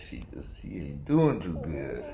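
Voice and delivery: usual speaking voice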